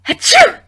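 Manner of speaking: a big, high, cheery fake sneeze; friendly sneeze. A narrator's reading. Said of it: "replied with her most friendly sneeze".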